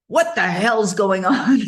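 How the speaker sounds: a light imitation of a big booming voice. Bob on the phone hearing her cry, a lightly voiced booming shout. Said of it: "in his big booming voice".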